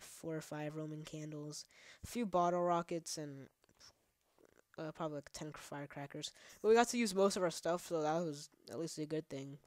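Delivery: ordinary delivery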